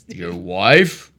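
low, slow cop voice; deep officer voice. Acted. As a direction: play the stern traffic cop low. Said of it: "Do different voices".

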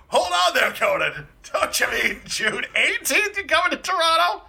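imitating Brian McCann. Sidekick cutting in on Conan, high mock voice. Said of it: "then Brian McCann go".

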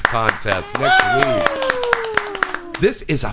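drawn out high and slow; hyped announcement. Real, not acted. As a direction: stretch it out like big exciting news. Announcing big contest news.

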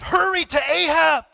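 loud urgent prophet's call; a prophet shouting urgent orders; Elijah shouting. Retold or imitated. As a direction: shout the warning to hurry. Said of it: "Elijah shouted".

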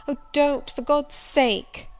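high, loud begging, drawn out; desperate plea. Acted. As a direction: implore them slowly and loudly. Begging not to be shut out.

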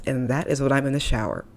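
normal talking voice

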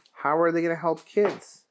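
ordinary delivery, nothing marked

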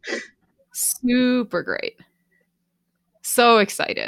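exaggerated fake enthusiasm, stretched out; heavily sarcastic. Real, not acted. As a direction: lay the sarcasm on thick. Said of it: "the sarcasm in my voice".

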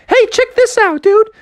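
very high squeaky showing off; excited surfer call. Acted. Frog sister to shark while surfing, squeaky excited call.

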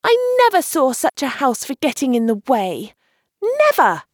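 high, frustrated exclamation; vexed little outburst; exasperated cry. A narrator's reading. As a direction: complain high, leaning on "never". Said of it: "she cried".

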